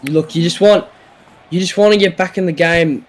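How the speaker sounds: usual speaking voice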